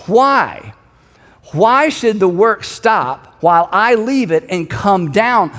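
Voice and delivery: slow, emphatic challenge from above; pointed question. Acted. Nehemiah looks down at the messengers, slow pointed question. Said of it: "He asks them a question".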